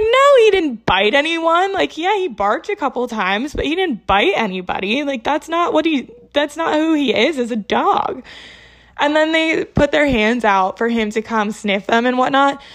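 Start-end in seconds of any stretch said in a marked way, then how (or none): 0.0-8.2 indignant denial. Retold or imitated. Owner defending her puppy to police, indignant. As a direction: leap up in pitch in disbelieving defense.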